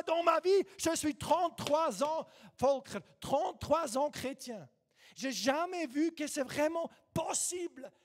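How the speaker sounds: loud fervent preaching. Real, not acted. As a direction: preach it out at full force. Preaching at full force.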